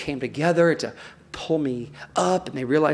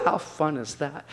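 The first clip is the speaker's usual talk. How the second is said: normal talking voice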